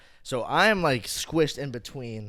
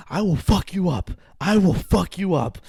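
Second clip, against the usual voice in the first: loud, high, forceful threat; angry threat; 9 semitones higher, 9 dB louder than usual. Retold or imitated. Furious fan cuts the man off, threatening. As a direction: threaten him point by point.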